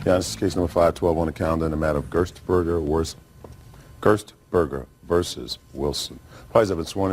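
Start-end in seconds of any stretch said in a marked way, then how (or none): none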